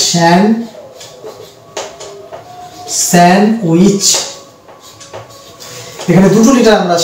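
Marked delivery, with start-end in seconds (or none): 0.1-4.0 স্যান্ডউইচ broken as "sen... wich"; correct silent-letter pronunciation. Shown as a demonstration. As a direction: model স্যান্ডউইচ with no D, pausing after "sen". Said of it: "এটার actual pronunciation হচ্ছে".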